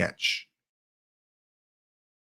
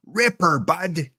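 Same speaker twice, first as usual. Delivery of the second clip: higher, put-on Canadian accent; mock Canadian lilt; 5 semitones higher, slower than usual. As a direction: lift it into a playful Canadian accent. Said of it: "That's more Canadian".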